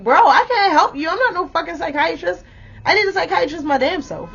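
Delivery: high-pitched, fed-up comeback; exasperated retort. Retold or imitated. Woman snapping back at needy ex, exasperated. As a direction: throw it back at him, pitched well up.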